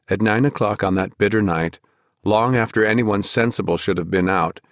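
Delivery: plain reading voice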